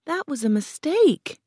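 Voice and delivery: put-on high little girl voice; meek Dorothy. A narrator's reading. Dorothy answers the wizard, high and girlish.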